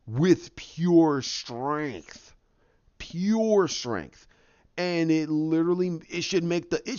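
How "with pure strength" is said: drawn out, very slow; slow emphasis. Retold or imitated. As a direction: stretch every word out slowly. Emphatic storytelling.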